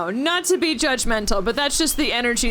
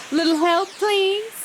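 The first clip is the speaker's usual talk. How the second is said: higher, feminine Southern drawl; Southern belle; 8 semitones higher than usual. Acted. Huntress calls for help from behind, Southern belle. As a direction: attempt a little Southern belle voice. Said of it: "She's a little Southern belle".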